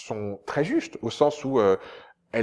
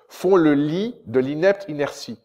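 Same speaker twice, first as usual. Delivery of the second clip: font le lit de l'inepte inertie, slow and weighted; declaimed verse; 4 semitones higher, 5 dB louder than usual. Shown as a demonstration. Translator reciting his verse as example, slow declamation. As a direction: recite it like a stage line. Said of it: "Je vous donne un autre exemple".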